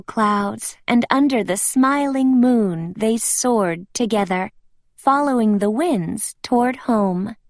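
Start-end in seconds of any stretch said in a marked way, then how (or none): none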